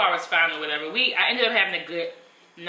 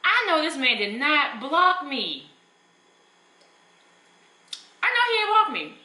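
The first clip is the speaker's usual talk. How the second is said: stunned disbelief; 6 semitones higher, slower than usual. Retold or imitated. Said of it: "So I'm like".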